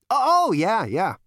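high, loud brash Zaphod; cocky Zaphod voice. Acted. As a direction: play it cool and cocky.

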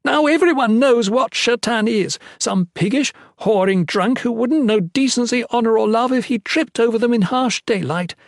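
high, heated tirade; acted yelling rage. A narrator's reading. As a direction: hurl the outrage at him. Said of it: "as she yelled at him".

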